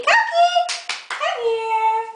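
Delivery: sing-song high call to the puppy; calling the dog. Real, not acted. Owner playfully calling her dog Cupcake, very high-pitched.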